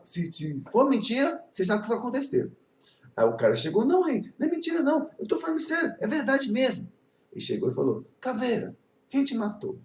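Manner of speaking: lively, animated storytelling with voices. A narrator's reading. Teacher tells the class a folk tale, animated. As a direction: tell it like a folk tale for kids.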